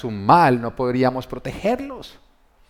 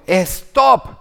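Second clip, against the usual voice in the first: loud, high sharp yell of stop; shouted command; 7 semitones higher, 7 dB louder than usual. Retold or imitated. Parent yelling to stop running son, loud. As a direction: shout it to freeze him in place. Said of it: "pero si yo grito".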